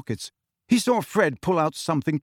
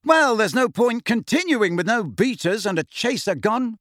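ordinary delivery, nothing marked

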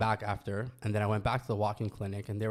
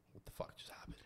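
unvoiced whisper of shock; whispering to yourself in shock; stunned whisper; 18 dB quieter than usual. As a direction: breathe it out in disbelief. Stunned after doctor's voicemail.

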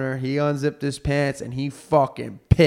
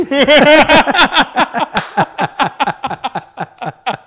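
high burst of laughter; long loud laugh; 11 semitones higher, 5 dB louder than usual. Real, not acted. Host cracking up after his joke. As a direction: crack up at the punchline.

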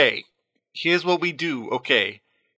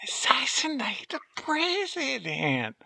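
hushed dramatic; 7 semitones higher, 8 dB quieter than usual. Acted.

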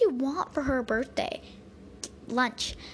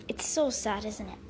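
ordinary delivery

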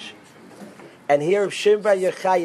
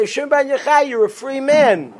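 higher and louder, a happy knock-and-tell; joyful announcement; 9 semitones higher, 5 dB louder than usual. Retold or imitated. The prophet happily announces freedom to Rishon ben Yochai, excited. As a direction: announce his freedom with glee. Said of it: "knock on the door and say".

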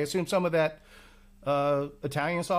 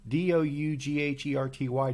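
ordinary delivery

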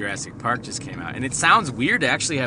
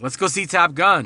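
put-on excited kid voice; 9 semitones higher, 10 dB louder than usual. Buddy voiced in story.

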